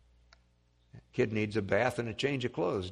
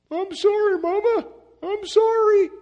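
put-on much higher, loud pleading child voice; sorry little boy; 11 dB louder than usual. Muddy little boy pleading with his mama, high whiny apology. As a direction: beg like a small boy caught out. Boy begs mother after mud.

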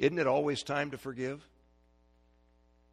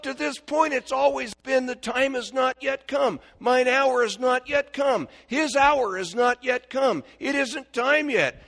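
preaching at full force; 12 semitones higher, 12 dB louder than usual. Acted. Preacher to congregation, loud fervent proclaiming. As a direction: proclaim it to the back pews.

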